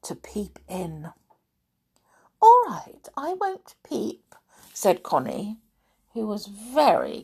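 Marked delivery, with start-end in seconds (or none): 2.4-4.4 put-on high-pitched child's reply; acted agreement. A narrator's reading. Connie's acted reply in the story.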